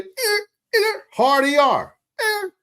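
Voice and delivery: high, mocking taunting call; imitating a kid's holler. A boy taunts a diner leaving, mocking holler. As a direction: taunt like a kid yelling out a slur. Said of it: "one of the young brothers hollered".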